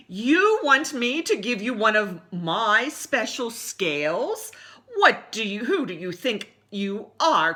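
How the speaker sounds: loud, slow, offended retort; indignant outburst. Acted. Rainbow Fish refusing the little fish, loud and indignant. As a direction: puff up and scoff. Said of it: "cried the Rainbow Fish".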